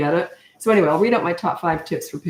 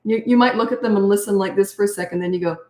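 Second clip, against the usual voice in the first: usual speaking voice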